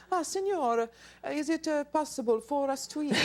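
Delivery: hammed-up tourist's Italian accent. Tourist asking for food in Italy, put-on Italian accent. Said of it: "if you have the Italian accent".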